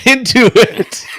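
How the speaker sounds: high, loud, drawn out with laughter; laughing. Real, not acted. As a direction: crack up on the words. Breaks up laughing at own pun.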